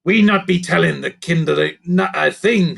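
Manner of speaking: a lightly voiced firm goblin; firm hiss. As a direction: warn the others. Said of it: "hiss firmly".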